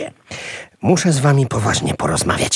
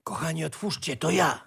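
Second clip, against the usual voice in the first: much softer, discreet call; hushed; 11 dB quieter, faster than usual. Acted. Uncle at the door asks to be let in, hushed. Calling softly from behind the door.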